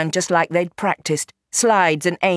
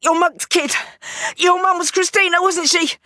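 pained gangster voice; 12 semitones higher than usual. Acted. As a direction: spit it out through the pain. Gangster naming her mother.

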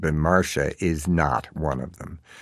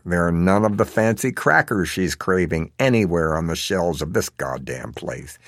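usual speaking voice

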